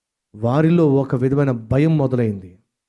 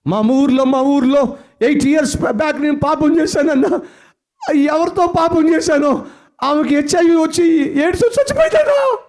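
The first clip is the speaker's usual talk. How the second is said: panicked confession; 14 semitones higher, 3 dB louder than usual. Retold or imitated. Frightened brother voiced in story.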